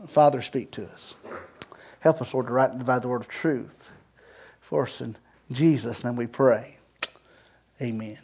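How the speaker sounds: low, soft pastoral prayer. Real, not acted. Pastor asking the Father to speak to us, hushed prayer. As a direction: lead them quietly in prayer.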